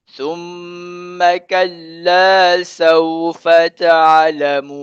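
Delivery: slow, drawn-out recitation; teacher modelling the verse for students, slow recitation; Quran recitation. Shown as a demonstration. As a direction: chant the verse slowly and evenly.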